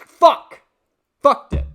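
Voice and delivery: sudden loud high curse; frustrated shout. Real, not acted. Streamer fumbling a tongue twister, loud angry outburst. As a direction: blurt it out in real frustration.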